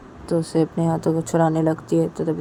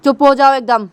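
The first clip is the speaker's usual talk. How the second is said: louder, rising yell to shut up; angry shout; 2 semitones higher, 8 dB louder than usual. Retold or imitated. Tisha shouting at Shanaya after the slap, angry yell. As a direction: slap her words down, shouting. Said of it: "Tisha गुस्से में आके".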